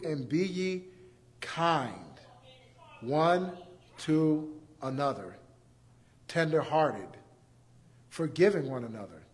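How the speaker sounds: a softly read verse on kindness; tender scripture reading. Real, not acted. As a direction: read it gently, like a blessing.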